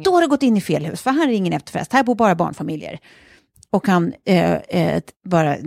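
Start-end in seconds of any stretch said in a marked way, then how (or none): 0.0-3.0 friendly but firm. Retold or imitated. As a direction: set him straight, no room for argument. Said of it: "bestämd".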